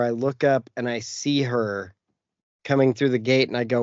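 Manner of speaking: normal talking voice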